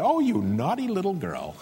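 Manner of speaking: lightly voiced doting mother, playful scolding; fond mock scolding. Grandparent cooing at a child on their lap, mock scolding. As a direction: tease the child you adore.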